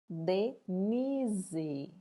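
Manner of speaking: "Denise" slowly split, stretched NI; stressed middle syllable. Shown as a demonstration. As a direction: sound out "Denise" syllable by syllable, leaning on NI. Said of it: "a gente pronuncia com mais entonação". ("Denise" devagar, separando as sílabas, com força no NI)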